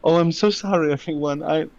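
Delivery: high, halting embarrassed character; apologetic Vendela. Acted.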